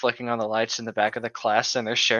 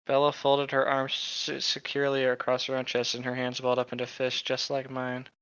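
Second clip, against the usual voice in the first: book reading; 7 dB quieter than usual. Acted. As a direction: read the line aloud, evenly.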